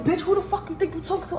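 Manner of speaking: fast, high, heated retort; snapping back at her, fired up; retold angry yell. Yelling at the customer who stepped to her, fast and high. Confronting the girl in locker room.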